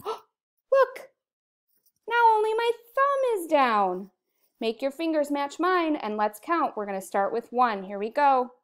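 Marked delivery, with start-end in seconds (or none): 0.0-4.1 gasp, then high excited reveal. Acted. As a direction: act amazed for the little ones. Teacher's mock amazement for kids.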